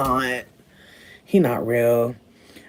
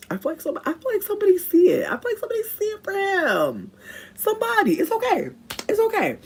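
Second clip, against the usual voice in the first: teasing squeal. Real, not acted. Teasing high voice to viewers.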